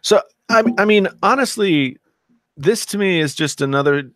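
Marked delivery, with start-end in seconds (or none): none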